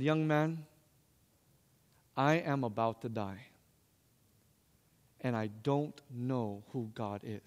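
very soft, low and slow, as a retold dying man; hushed dying confession. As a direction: confide it gravely in a low hush. Retells dying man's solemn confession.

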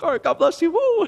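high-pitched, comic blessing in retreat; hasty retreat. Retold or imitated. Worker backing away from cursing dealer, comically hasty. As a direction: toss out a nervous blessing, then whoop.

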